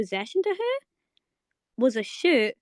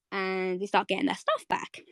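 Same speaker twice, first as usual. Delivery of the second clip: ordinary talk, nothing marked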